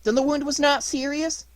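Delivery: very high, eager puppet question; delighted. A narrator's reading. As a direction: pitch it way up with joyful relief. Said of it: "dancing with delight".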